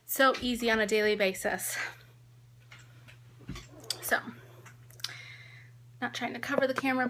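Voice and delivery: ordinary talk, nothing marked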